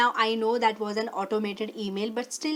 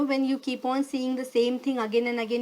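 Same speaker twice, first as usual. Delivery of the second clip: ordinary delivery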